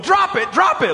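high yelled command to drop it; yelling at the bear. Retold or imitated. Pastor yelling at a bear stealing his cooler, high shout. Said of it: "I have a video of me going".

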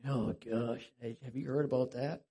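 low, understated grumble; passive-aggressive grumbling. Acted. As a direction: grumble it politely, low and under your breath. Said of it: "That's grumbling".

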